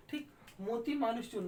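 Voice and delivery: ordinary delivery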